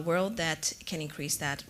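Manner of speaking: ordinary talk, nothing marked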